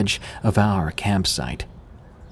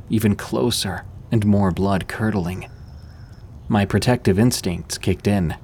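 ordinary delivery, nothing marked

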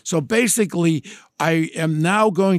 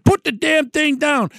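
much higher, insisting pitch; insistent order; 8 semitones higher than usual. Retold or imitated. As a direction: push the pilot, pitch jumping up. Pilot refuses, he insists.

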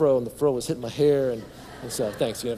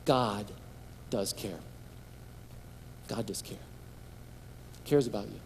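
very soft and low, with pauses; tender, low-voiced assurance to the listeners; hushed reassurance; 6 semitones lower, 12 dB quieter than usual. Real, not acted. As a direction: let each phrase land gently and quietly. Quiet reassurance after asking careful listening.

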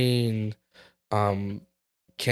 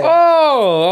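excited loud oh; 8 dB louder than usual. Real, not acted. Excited outburst.

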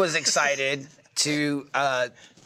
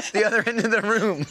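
ordinary talk, nothing marked

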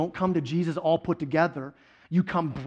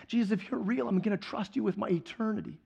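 softer, understated pledge; quiet vow; 7 dB quieter than usual. Retold or imitated. New believer pledging trust to Jesus, softly voiced. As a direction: bring the voice down for a sincere promise.

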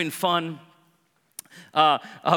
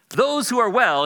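loud, raised-pitch call; shouted out; 6 semitones higher, 6 dB louder, slower than usual. Acted. Jesus shouting to the Pharisees outside, calling out. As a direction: call it out the window. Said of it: "he kinda shouts it out the window".

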